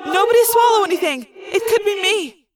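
high, urgent echoing warning; alarmed cry. A narrator's reading. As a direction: warn everyone in a panic. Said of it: "I cried".

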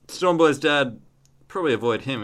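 ordinary talk, nothing marked

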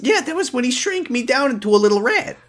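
high little rat voice, cheerful; squeaky rat sidekick. Acted. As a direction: play the tiny rat bodyguard. Plays Sunglasses rat role.

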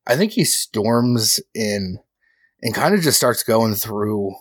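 ordinary talk

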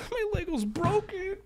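high, soft whiny little voice; mock sad whimper. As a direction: play it like a sulking kid. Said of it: "then you go".

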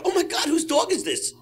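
high, hammed-up shock; high fake astonishment; mock surprise. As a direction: play dumb and squeal it. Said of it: "I go".

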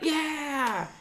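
a high, hammed-up whoop; high joyful yell of a teammate; mock cheer. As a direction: cheer like a player catching a beer. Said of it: "and he would be like".

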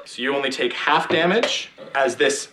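ordinary delivery

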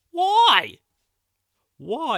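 high, loud exasperated why; exasperated cry. Real, not acted. Baffled by junk over the fence, loud exasperated cry. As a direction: throw up your hands and cry out.

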